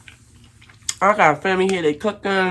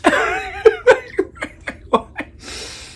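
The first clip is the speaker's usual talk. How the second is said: very high cackling laugh; high mock-hysterical laugh; shrieking laugh; 3 dB louder than usual. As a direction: cackle wildly between the yells. Mocking the screaming neighbor.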